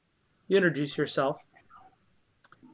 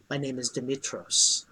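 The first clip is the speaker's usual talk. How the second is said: lightly voiced ancient ghost, calm and solemn; ghostly voice in your head; 3 semitones lower, 6 dB quieter than usual. A narrator's reading. Translucent spirit thanks the heroes, speaking softly in their heads. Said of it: "in a voice that is not verbal, but is in your head".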